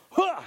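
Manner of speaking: sharp high loud huh; high grunt of effort. Acted. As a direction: heave it up with a yelp. [name] strains holding umbrella.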